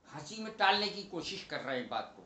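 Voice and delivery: normal talking voice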